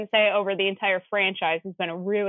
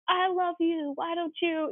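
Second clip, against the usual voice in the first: high, soft mock gushing; over-the-top profession; 9 semitones higher, 5 dB quieter than usual. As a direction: overdo the declaration, high and soft. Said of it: "He's not professing over the top".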